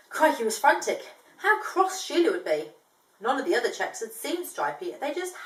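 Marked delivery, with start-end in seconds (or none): none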